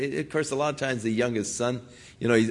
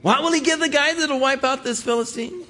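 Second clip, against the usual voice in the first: much higher, bright boyish put-on voice; eager young kid. Young David asking the soldiers about the reward, high and eager. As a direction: ask it like an excited kid. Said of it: "and he said".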